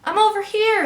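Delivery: high, loud answering call; yelling. A narrator's reading. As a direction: yell back so rescuers can find you. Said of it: "he yelled".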